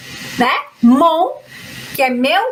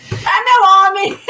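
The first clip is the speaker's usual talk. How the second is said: amused mock quote; 3 semitones higher, 3 dB louder than usual. Joking at an unused phrase.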